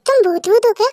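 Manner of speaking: usual speaking voice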